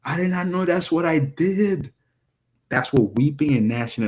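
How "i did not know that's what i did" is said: imitating someone dismayed, higher and slowed; mock dismayed gasp of regret; remorseful realization. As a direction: play the sinner who just realized. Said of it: "and you now figured out".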